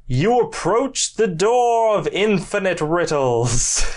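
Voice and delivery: a door knocker posing a riddle grandly; regal purring riddling voice. Acted. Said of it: "the knocker face ... says".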